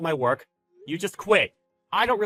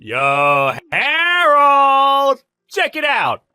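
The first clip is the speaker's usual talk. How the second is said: loud, high, drawn-out holler; crowing shout across the office; gloating call; 11 semitones higher, 9 dB louder, much slower than usual. Acted. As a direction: yell for him to come and look. Boss calls out to employee gloatingly.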